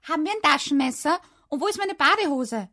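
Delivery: high, loud, quick shout. A narrator's reading. Child hunting for packing things, shouting. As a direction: yell questions through the flat, fast. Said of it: "schrie ich".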